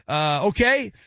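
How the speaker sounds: ordinary delivery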